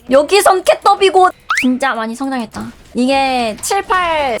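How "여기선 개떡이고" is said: 개떡이고 hit hard and loud; loud boast. Real, not acted. Gamer brags to chat, loud. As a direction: punch out 개떡이고 loud and high. Repeating his boast louder.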